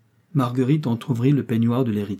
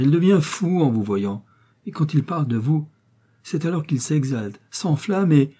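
higher and louder, rushing with enthusiasm; gushing excitement; 5 semitones higher, 4 dB louder than usual. Acted. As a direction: sweep her off her feet with praise. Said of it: "continua Marguerite avec plus de calme".

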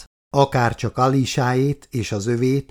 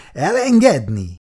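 higher, louder scornful question; mocking laugh; 5 semitones higher, 4 dB louder than usual. A narrator's reading. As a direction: laugh the word back at him. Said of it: "nevetett fel Lexa".

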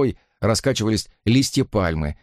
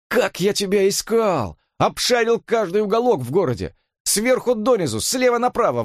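Jimmy, excited; 11 semitones higher than usual. A narrator's reading. Jimmy tells Harvey how he searched everywhere, high and gushing. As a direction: pour out how hard he searched.

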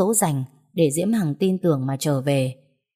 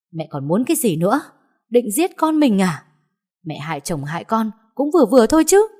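higher and louder accusing yell; furious reproach; 6 semitones higher, 6 dB louder than usual. Acted. Daughter-in-law yelling at her mother-in-law, furious. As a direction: lash out at her.

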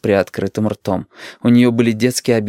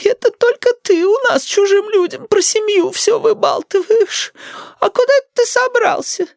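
suspicious grandma. A narrator's reading. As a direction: accuse and probe as a mistrustful granny. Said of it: "подозрительно спросила бабуля".

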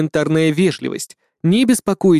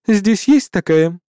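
polite gnome lady; 4 semitones higher, slower than usual. A narrator's reading.

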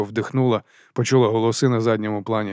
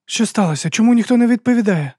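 high, louder anxious questions; alarmed; 11 semitones higher, 5 dB louder than usual. Acted. Woman asking why nobody answers on the radio, alarmed. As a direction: demand answers in alarm, pitch climbing.

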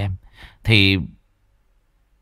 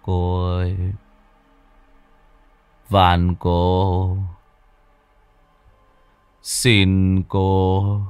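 dazed stammering plea; much slower than usual. A narrator's reading. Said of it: "miệng lắp bắp".

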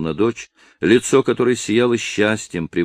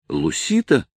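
high-pitched astonished question; surprised; 11 semitones higher than usual. A narrator's reading. Dolores spotting the girl running up, surprised. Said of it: "с удивлением спросила Долорес".

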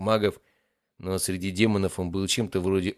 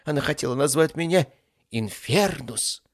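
indignant objection; 8 semitones higher, 4 dB louder than usual. Acted. Ifrit defends his intended name to master, indignant. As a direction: protest with full conviction. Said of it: "возразил Ифрит, убеждённый в своей правоте".

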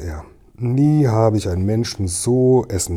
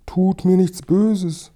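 high, slow frightened plea; fearful pleading; 10 semitones higher, 3 dB louder, slower than usual. A narrator's reading. Old man to strangers he fears, pleading anxiously. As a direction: beg in a thin, high voice. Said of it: "flüsterte er".

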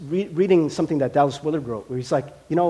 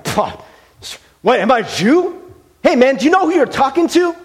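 higher and louder, a scoffing Roman official; indignant Pilate; 7 semitones higher, 6 dB louder than usual. Retold or imitated. Pilate scoffing at Jesus, loud and indignant. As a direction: scoff and puff up with offended pride. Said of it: "I imagine Pilate's like".

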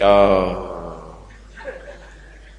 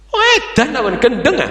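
jumps high in disbelief; mock outrage, much higher; shocked exclamation; 7 semitones higher than usual. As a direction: cry out that he's mad. Father's shocked reaction.

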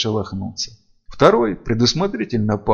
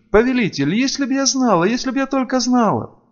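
high, pleading female character voice; distraught, high-pitched remorse; remorseful vampire servant; 13 semitones higher than usual. A narrator's reading. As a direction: plead regretfully with a master.

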